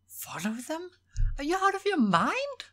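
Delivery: very high-pitched incredulous outburst; disbelief. A narrator's reading. Horace challenges Will's plan to follow them, incredulous. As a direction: shoot the question up in sheer disbelief. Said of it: "unable to believe what he was hearing".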